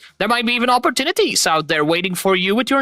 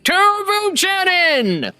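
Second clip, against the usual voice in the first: excited greeting; 6 semitones higher, slower than usual. Real, not acted. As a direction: throw your arms open to the chat. Streamer's big opening greeting.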